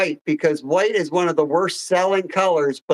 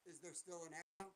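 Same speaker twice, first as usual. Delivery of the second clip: breaking up space radio; 36 dB quieter than usual. Real, not acted. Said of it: "you're cutting in and out".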